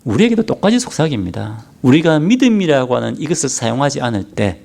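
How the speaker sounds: normal talking voice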